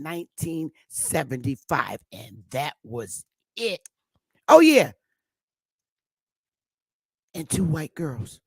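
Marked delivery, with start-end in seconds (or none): 4.5-4.9 much higher, louder oh yeah; emphatic loud agreement; loud exclamation. Real, not acted. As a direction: shout it out high.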